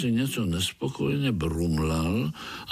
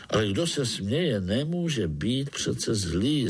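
ordinary delivery

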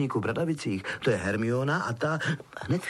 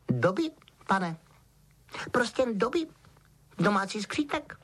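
small squeaky creature voice, pitched up; Dobby squeaking; 8 semitones higher than usual. A narrator's reading. Elf introducing himself to Harry, squeaky. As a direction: squeak like a timid house-elf. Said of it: "Písklo stvořeníčko".

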